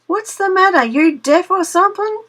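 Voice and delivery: impatient shout. Acted. Brer Rabbit yelling at the deaf-seeming Tar Baby, loud and high. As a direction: holler at the silent doll. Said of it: "says Brer Rabbit".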